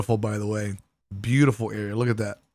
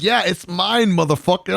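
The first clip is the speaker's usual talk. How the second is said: mock possessive; 7 semitones higher than usual. Claiming cake back from Piercho.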